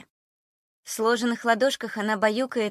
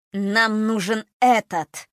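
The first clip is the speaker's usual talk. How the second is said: slow, loud, low eerie voice; low unfamiliar voice; 5 dB louder, slower than usual. Acted. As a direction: drag each word out menacingly. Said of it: "Слишком низко и незнакомо прозвучал голос".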